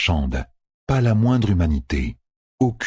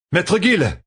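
glad call, pitched up; exclaiming; 12 semitones higher than usual. Acted. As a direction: call out to an old friend. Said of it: "s'exclama Rand". (Rand s'exclame en reconnaissant l'aubergiste)

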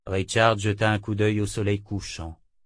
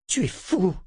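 high-pitched, tight-jawed anger; through clenched jaws; 9 semitones higher than usual. A narrator's reading. Verna to Richard after his orders, angry through clenched jaws. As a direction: force it out through clenched teeth. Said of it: "lâcha Verna entre ses mâchoires serrées".

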